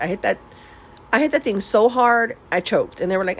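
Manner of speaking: ordinary talk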